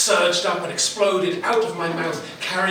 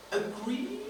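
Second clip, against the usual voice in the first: soft, high rising query; softly incredulous, pitched up; questioning echo; 10 semitones higher, 7 dB quieter than usual. A narrator's reading. Her incoming transmission, quoted questioningly.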